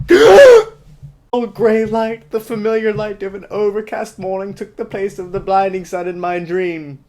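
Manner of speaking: very high, very loud melodramatic wail; hammed-up high swoon; mock dramatic. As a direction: shriek it like a soap heroine. Mock Bella reading, shrill.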